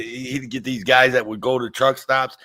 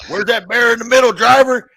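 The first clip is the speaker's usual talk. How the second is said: mock trucker on the CB; 14 semitones higher, 7 dB louder than usual. Trucker asking over CB.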